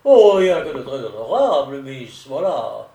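loud burst, then falling away on "voilà"; exclaiming. A narrator's reading. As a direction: cry out a hearty protest of honesty. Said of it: "s'écria". (exclamation forte et théâtrale)

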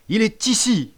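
high, loud yelp. A narrator's reading. Boy pointing out the traitor, high yelp. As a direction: yelp out the accusation. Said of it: "glapit la crique".

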